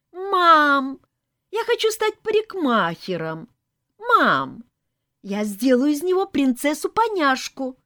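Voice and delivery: put-on high, loud, eager kid's voice; child exclaiming. A narrator's reading. Child announces hairdresser plans to mom, exclaiming. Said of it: "воскликнула детская курносая мордашка".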